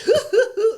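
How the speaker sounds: high, hearty mock laugh; imitated big laugh. Imitating the mentor's laugh for his podcast co-host, high and loud. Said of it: "he'd laugh like this".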